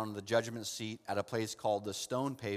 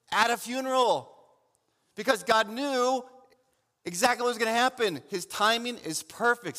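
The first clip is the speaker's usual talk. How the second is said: loud, high, urgent preaching; full-force preaching; 8 semitones higher, 6 dB louder than usual. Acted. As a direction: preach it out to the whole church.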